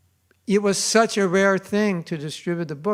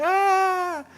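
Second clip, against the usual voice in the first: high, bright yelp of joy; a sudden happy shout; mock ecstatic squeal; delighted cry; 5 dB louder than usual. Devotee balancing books, taking photos.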